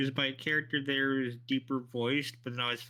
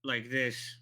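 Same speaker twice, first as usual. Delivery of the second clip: a bit lower, deeper guy voice; 4 semitones lower than usual. Shown as a demonstration. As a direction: drop the voice for the character. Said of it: "this guy who talks lower".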